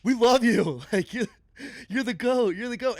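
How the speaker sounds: high, beaming mock-adoring praise; playful high-pitched love for a rival; gushing affection. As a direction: gush at him like a big fan. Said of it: "we were like".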